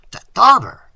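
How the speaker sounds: high, loud, stuttered disbelief; affronted stammer. A narrator's reading. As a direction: stumble on the word in shock. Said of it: "he stammered".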